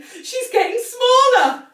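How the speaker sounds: high, loud, slow gleeful shout. A narrator's reading. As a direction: crow with delight. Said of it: "shouted Mister Cranky gleefully".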